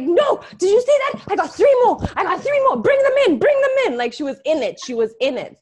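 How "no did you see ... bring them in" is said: much higher and louder, hyped shouting; fighter's shout. Retold or imitated. Grandaunt in the ring calling for more fighters, hyped shout. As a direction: bellow for more opponents. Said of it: "her sister would be in the ring being like".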